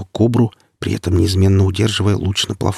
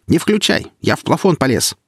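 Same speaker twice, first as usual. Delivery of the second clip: calling out not to turn on the power; shouting warning; 7 semitones higher than usual. Acted. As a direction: yell so he won't switch it on.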